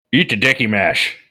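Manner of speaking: talking around a cigarette; cigarette in mouth. Retold or imitated. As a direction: say it with a cigarette between the lips. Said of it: "He sounds exactly the same with the cigarette".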